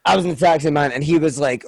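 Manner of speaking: ordinary delivery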